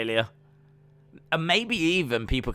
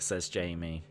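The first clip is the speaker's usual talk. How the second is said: normal talking voice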